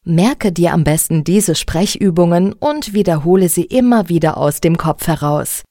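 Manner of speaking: ordinary delivery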